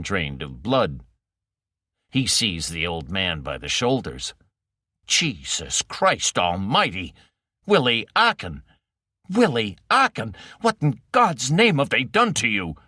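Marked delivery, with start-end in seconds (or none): 5.0-12.8 shocked outcry. Acted. Burnett recognizing his kidnapped friend, horrified outcry. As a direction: cry out in disbelief and horror.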